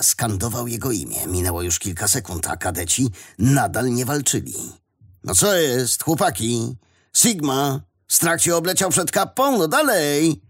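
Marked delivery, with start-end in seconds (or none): 5.2-10.4 higher-pitched goading of an opponent; taunting. A narrator's reading. Fighter daring his hesitant friend to attack, taunting. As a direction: egg him on, voice rising.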